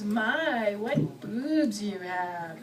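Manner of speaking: slow, soft leering purr; leering creep. Pretend. To a woman's chest, a slow soft leer. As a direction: ogle and purr it slowly.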